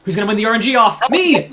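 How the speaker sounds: loud, high, triumphant call; excited hype. Real, not acted. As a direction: hype yourself up. Hyping self as winner.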